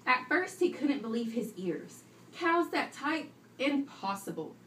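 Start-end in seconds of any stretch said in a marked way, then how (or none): none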